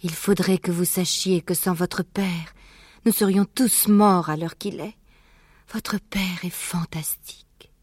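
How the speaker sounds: high, slow mother's voice, fading to breath; tender admiration. Acted. Mother fox praising father to her children, tender.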